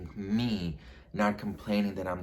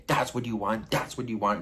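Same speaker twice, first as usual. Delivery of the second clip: louder, punched retold challenge; acted-out forceful demand; aggressive taunt; 3 semitones higher, 5 dB louder than usual. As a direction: throw it at them as a taunt.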